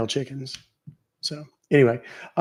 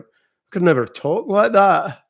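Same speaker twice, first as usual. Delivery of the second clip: higher, louder mock-Scottish; Scottish accent attempt; 5 semitones higher, 6 dB louder than usual. Streamer trying to replicate the girl, Scottish accent. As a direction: have a go at broad Scottish. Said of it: "trying to replicate it".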